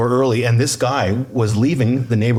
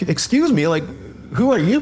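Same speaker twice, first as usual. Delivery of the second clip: raised-pitch retold challenge; challenging a stranger; 7 semitones higher than usual. Husband questions a stranger removing his shoes, challenging. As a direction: act out confronting an unexpected intruder, pitched up. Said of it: "kind of thing".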